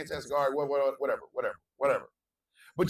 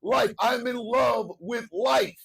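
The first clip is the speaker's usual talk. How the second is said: higher, louder forceful declaration; high and strong; 5 semitones higher, 3 dB louder than usual. Real, not acted. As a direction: belt it out like angry love. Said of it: "My voice is gonna get real high and real strong in anger".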